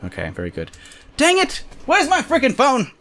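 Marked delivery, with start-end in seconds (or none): 1.2-2.9 high-pitched frustrated cry; exasperated outburst. Real, not acted. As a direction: burst out, fed up. Frustrated at missing phone.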